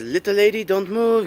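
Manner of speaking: high-pitched mock French accent; imitated French accent. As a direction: quote the robot in a put-on French accent. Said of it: "Hot Rod is stuck with a French accent".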